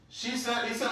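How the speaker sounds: usual speaking voice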